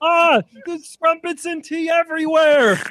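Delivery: very high, drawn-out impression whine; comic bewildered character. Host plays a character lost in Britain, high drawn-out bafflement. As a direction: wail it slowly, way up high.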